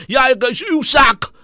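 high loud cheeky retort; mock pupil shout. Retelling a pupil shouting back at the teacher, hammed-up. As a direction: shout back like a defiant kid.

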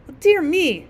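a little firework taken aback; surprised little squib. Acted. Little squib objects to the rocket, politely surprised.

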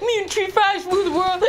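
words lost in laughter; laughing fit. Real, not acted. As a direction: giggle the words out. Giggling fit.

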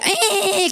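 high squeaky eh eh like a dolphin; dolphin noises. Retold or imitated. Mother imitating her daughter's dolphin sounds, high squeak. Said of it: "conductas de delfín".

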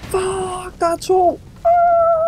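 high shout rising into a scream; panicked scream. Real, not acted. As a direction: yell out as two attackers appear. Two enemies attack. (panisk skrig)

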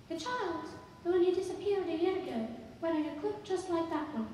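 high-pitched woman's voice; woman explaining. Acted. Woman explaining the child vanished long ago, informative.

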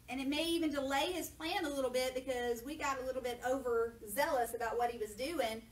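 ordinary talk

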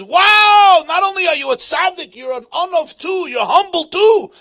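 high, loud, gushing praise; mock delight. Shmuel mockingly praises the terrified preacher, loud and gushing. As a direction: cheer him on as if thrilled.

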